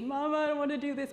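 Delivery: lightly voiced whiny little boy, fast; imitating a crying child. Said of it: "He's crying in the back seat".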